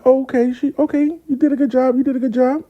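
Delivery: high-pitched approving reaction; impressed approval. Retold or imitated. Mentor seeing his friend's new haircut, high-pitched approval. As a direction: lift it way up, pleasantly impressed.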